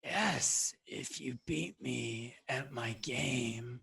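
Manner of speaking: breathy, raspy maze creature; rasping, wheezing creature. Acted. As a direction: answer in a wheezing rasp. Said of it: "rasping, wheezing voice".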